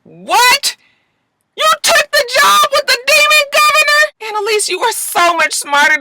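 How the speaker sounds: real outrage yelled at the TV; outraged shout. Real, not acted. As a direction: shout at her in disbelief. Shouting at character on screen.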